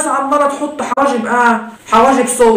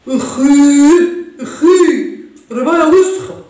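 disgusted father; 3 semitones higher, much slower than usual. Acted. Disgusted parent reacts.

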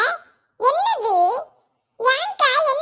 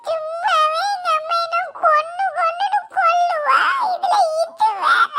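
crying; 3 semitones higher than usual. Acted. Child dreading mother's beating, crying. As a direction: wail it through sobs, pitched up. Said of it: "எதுக்கு அழுதுட்டே நிக்க?".

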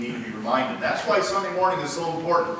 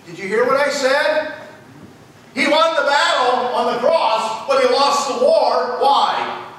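raised, high-pitched pulpit cry; full-force preaching; 11 semitones higher, 7 dB louder, slower than usual. Acted. Preacher declaring the devil lost, full-force preaching. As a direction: proclaim victory to the congregation, drawn out.

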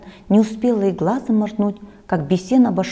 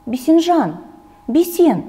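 calls the name higher and a bit louder; calling out; 8 semitones higher, 4 dB louder, slower than usual. A narrator's reading. Grandmother calling her grandson by name, calling out. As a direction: call him from across the yard.

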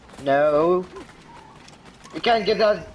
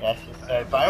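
ordinary talk

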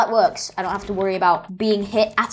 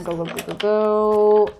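ordinary delivery

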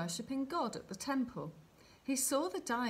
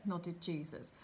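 ordinary delivery, nothing marked

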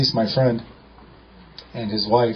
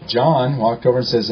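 normal talking voice

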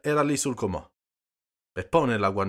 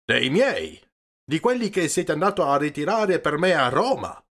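louder, higher, confrontational claim; heated accusation at raised pitch; insistent demand; 8 semitones higher, 5 dB louder than usual. A narrator's reading. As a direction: press your claim on the money forcefully. Peppone confronts priest about money.

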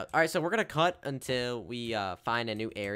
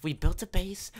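loud, higher celebratory announcement; triumphant cheer; 7 semitones higher, 6 dB louder than usual. Acted. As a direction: proclaim it like a victory.

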